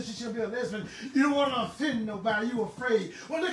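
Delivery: ordinary talk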